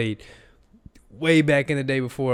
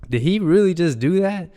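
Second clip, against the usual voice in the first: higher, louder incredulous question; retold amazement; 6 semitones higher, 5 dB louder than usual. Analyst reliving the first play he saw, loudly incredulous. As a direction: replay your astonished reaction, pitched up.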